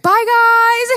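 high, loud sing-song farewell; older man calling goodbye from his car; cheery goodbye. Retold or imitated. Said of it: "he was like".

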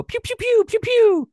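high, loud mock laser pew pews; blaster noises. Ship firing at the fan, hammed-up high pew pews. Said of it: "That ship would look at you and go".

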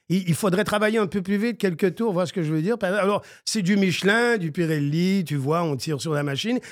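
a mild France French driver on the phone; hint of a France French accent; imitating Olivier Panis. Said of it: "D'Olivier Panis".

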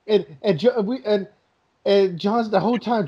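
usual speaking voice

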